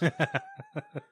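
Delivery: high laugh. Real, not acted. Laughing at the cartoon, a high burst of laughter. Laughs after own retort.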